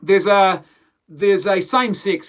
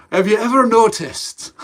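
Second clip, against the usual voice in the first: mock-Scottish accent, higher and louder; Scottish conspiracy theorist; 6 semitones higher, 7 dB louder than usual. Vlogger imitating a Scottish conspiracy theorist, loud mock accent. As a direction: pitch it up as a conspiratorial Scot. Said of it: "the Scotsman who's a bit of a conspiracy theorist".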